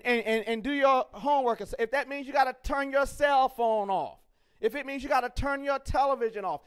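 ordinary talk, nothing marked

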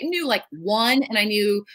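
normal talking voice

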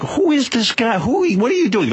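high, alarmed mock Tracy demanding who this is; a stranger barges into his space; freaked-out Tracy. Tracy confronting the intruder, high and alarmed. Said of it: "he's like".

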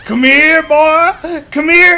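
high, loud taunt to a dog; 1 high mocking monster call. Monster calling after a fleeing Ian, high and loud.

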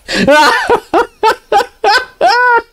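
very high squealing laughter; hysterical cackle. Real, not acted. Streamer laughing at the replayed clip, hysterical cackle. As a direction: crack up completely.

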